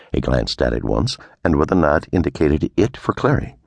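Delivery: ordinary delivery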